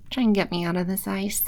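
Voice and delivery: ordinary delivery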